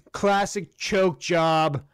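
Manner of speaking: ordinary delivery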